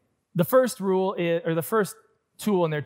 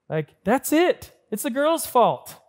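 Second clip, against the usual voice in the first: higher, louder and slow, exasperated; fed up; 8 semitones higher, 5 dB louder, slower than usual. Retold or imitated. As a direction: blurt out the blame, done with the questions. Fed up with wife's questions.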